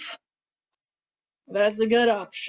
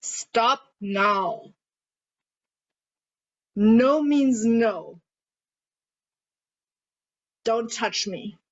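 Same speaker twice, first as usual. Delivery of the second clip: firm boundary voice; slower than usual. Shown as a demonstration. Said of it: "And yeah".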